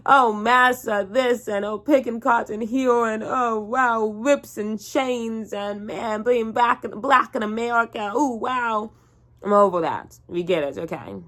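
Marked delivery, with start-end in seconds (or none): 0.1-8.9 slow, higher, sneering fake wonder at the clichés; lightly voiced mock gasps of amazement; sarcastic mock awe. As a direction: drawl it like a bored critic mocking the genre. Mocking serious slave movies.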